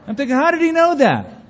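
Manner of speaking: high, loud comic disbelief; hammed-up incredulous squeal; mock astonishment. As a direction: act baffled at being found out, pitch shooting way up. Comic disbelief at being found out.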